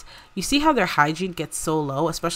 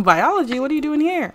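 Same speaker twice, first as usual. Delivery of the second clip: sharply rising disbelief, louder; surprised; 10 semitones higher, 5 dB louder, faster than usual. Pretend. Coworker reacting in shock to a biology major. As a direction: blurt it out in shock.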